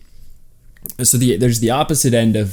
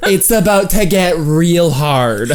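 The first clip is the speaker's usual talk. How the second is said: put-on Southern accent; 5 semitones higher than usual. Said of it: "they don't say it in a Southern accent".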